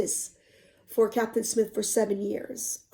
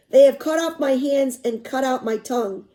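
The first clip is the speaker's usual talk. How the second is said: usual speaking voice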